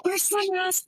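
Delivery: playful fake sweetness; sudden gushing. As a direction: gush over him in a sudden swoon. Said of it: "I'm all like".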